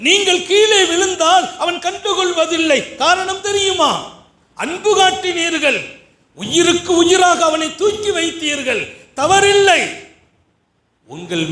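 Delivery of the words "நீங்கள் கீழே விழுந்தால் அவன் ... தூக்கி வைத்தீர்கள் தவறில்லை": fiery preaching. Acted. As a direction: thunder the point at the parents.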